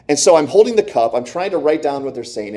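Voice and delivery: ordinary delivery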